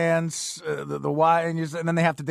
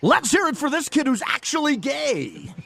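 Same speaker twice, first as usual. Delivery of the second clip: wedding DJ voice; 6 semitones higher than usual. Pretend. Mock wedding DJ announcing.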